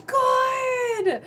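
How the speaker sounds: high, loud, pleased exclamation; delighted. Real, not acted. Healer cheering a viewer's comment about a session, delighted. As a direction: cheer the viewer's good news.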